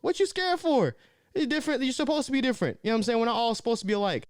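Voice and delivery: rally them to own it; fired up. Real, not acted. Speaker urging viewers to live their difference, fired up.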